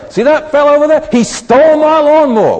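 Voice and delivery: hammed-up accusing of the borrower; mock outraged accusation. The churchgoer to the neighbour, mock outraged and loud.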